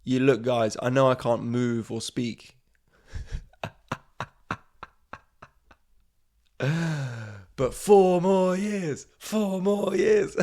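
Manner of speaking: mock old-man rally chant; Biden impression. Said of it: "be like".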